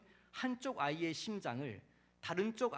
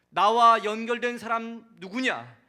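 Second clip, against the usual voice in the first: loud, higher demand to step forward; commanding shout; 3 semitones higher, 12 dB louder than usual. Acted. Preacher as the Lord summoning someone forward, loud command. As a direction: issue a forceful, authoritative order to the crowd. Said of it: "이런 명령을 하셨다는 거예요".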